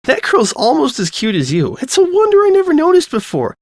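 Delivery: put-on high, loud bubbly girl voice. Lyra teasing her friend about Sudsy, high bubbly voice. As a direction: gush over her friend, pitched high.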